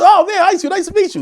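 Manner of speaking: put-on high, eager little boy; excited kid. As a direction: starstruck young kid. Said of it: "I was a little dude".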